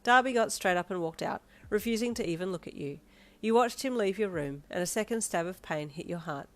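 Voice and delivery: usual speaking voice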